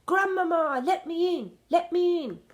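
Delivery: high, loud desperate call; boy shouting for grandmother. A narrator's reading. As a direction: yell it through the door. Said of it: "I shouted as loudly".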